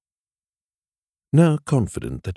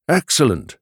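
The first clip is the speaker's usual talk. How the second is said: higher, louder delighted exclamation; pleased exclamation; 8 semitones higher, 5 dB louder than usual. A narrator's reading. David hearing of a telegram, pleased exclamation. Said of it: "David exclaimed".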